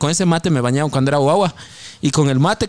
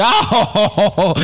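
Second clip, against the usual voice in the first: hammed-up jo jo laugh; imitated teasing laugh; mock laugh; 5 semitones higher, 3 dB louder, slower than usual. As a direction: laugh it out like the wife. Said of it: "Y salió riendo, dice".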